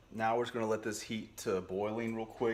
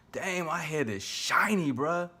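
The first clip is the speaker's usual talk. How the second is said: loud, high, drawn-out exclamation; mock shock; 9 semitones higher, 8 dB louder, slower than usual. Real, not acted. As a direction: exclaim in exaggerated dismay. Mock shock at his own head.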